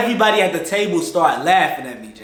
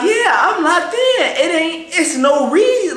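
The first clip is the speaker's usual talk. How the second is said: eager hype; 9 semitones higher than usual. Retold or imitated. Teen self hyping himself up.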